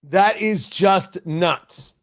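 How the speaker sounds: drawn out, each word weighted; slow emphatic. Real, not acted. Host dismisses the accusation, slow and emphatic. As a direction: spell it out slowly in disbelief. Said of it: "slow emphatic".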